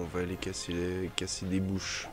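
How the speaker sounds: ordinary talk, nothing marked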